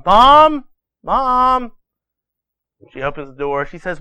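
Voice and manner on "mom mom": put-on higher-pitched kid calling for Mom. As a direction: call out like a boy from bed. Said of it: "he yells out to his mom".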